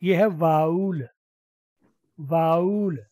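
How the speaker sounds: वाउल drawn out slowly as vow-ul; vowel said right. Shown as a demonstration. Teacher to class, slow careful model of वाउल. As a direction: model वाउल slowly, two clear beats. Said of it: "इसकी आवाज़ वावल नहीं है".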